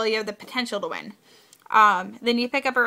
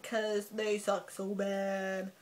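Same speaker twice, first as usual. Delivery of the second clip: disgusted; 5 dB quieter, slower than usual. Real, not acted. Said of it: "The only card I really don't like is red".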